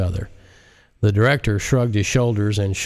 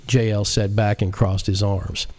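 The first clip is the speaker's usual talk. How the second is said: ordinary delivery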